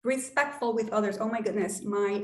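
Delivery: usual speaking voice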